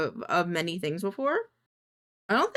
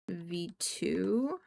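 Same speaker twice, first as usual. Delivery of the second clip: usual speaking voice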